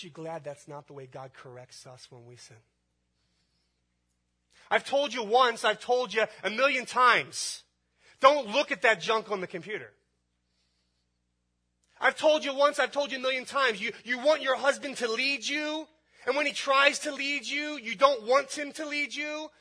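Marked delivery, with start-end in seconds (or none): 4.7-9.9 loud, high-pitched parental yelling; angry scolding. Acted. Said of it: "So you really speak like that?". 12.0-19.5 loud, high, relentless tirade; angry scolding. Acted. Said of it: "doesn't talk to us like that".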